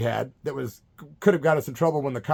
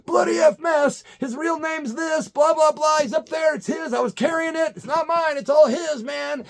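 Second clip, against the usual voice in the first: high, loud frantic shouting; mimicking a friend screaming and snitching in terror; panicked yelling; 13 semitones higher, 3 dB louder than usual. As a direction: yell it all out in a panic to the cops. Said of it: "immediately starts yelling".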